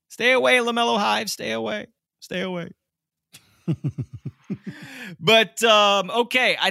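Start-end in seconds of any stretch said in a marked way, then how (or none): 0.1-2.7 mock warning. Mock warding off fans.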